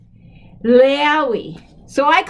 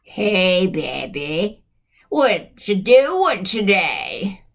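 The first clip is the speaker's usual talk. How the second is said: low, slow, drawn-out come-on; sleazy caller; 5 semitones lower, much slower than usual. Acted. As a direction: play a slow low-voiced phone flirt. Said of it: "just like this".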